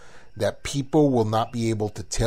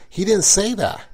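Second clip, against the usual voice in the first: ordinary talk, nothing marked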